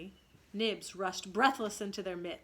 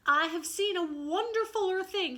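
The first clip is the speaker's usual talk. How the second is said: much higher, loud wonder; eager cry; 9 semitones higher, 6 dB louder than usual. Acted. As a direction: burst out with the news. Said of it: "he cried".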